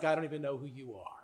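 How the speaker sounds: low, soft and fast, brushing off a stranger; a quick quiet shrug of a voice; lightly mocked dismissive God; cold dismissal.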